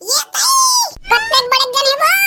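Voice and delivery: high, crying shriek of fright; terrified scream. Acted. Seeing how big the snake is, screaming high and crying in terror. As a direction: scream like the snake is right on you.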